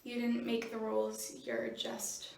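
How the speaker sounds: soft, remorseful, falling away to almost nothing; subdued apology. Acted. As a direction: back down quietly after the outburst. Backs down, apologises to mother.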